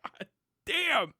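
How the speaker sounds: ordinary delivery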